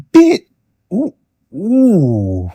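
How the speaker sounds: very high, loud ooh; squealing disbelief. Real, not acted. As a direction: shriek of mock shock. Reacting with disbelief.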